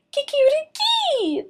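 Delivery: very high loud rooster crow; furious crow. Acted. Enraged rooster crowing at Martina after coffee spill. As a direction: crow with fury.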